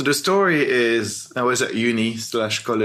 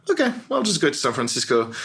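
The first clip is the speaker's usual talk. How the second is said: quick, higher self-quote, easily agreeing; breezy acceptance; 5 semitones higher, faster than usual. Retold or imitated. Young intern accepting the move, breezy and quick. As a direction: toss off the decision lightly. Acted quote of his reply.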